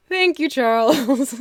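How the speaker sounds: high, slow words through laughter; real laugh running through the words; laughing thanks. Real, not acted. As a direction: say thanks while cracking up. Laughing while thanking a viewer.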